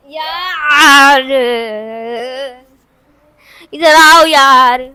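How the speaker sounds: loud, drawn-out call to come over. Real, not acted. Calling the restless pet to come and study, loud and drawn out. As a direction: call her over loudly and slowly, losing patience.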